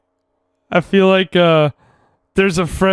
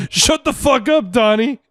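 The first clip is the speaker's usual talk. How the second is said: higher, shouted Walter line; hammed-up yell; mock shout; 7 semitones higher than usual. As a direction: bark it at a chatty friend. Yelling Walter line imitated.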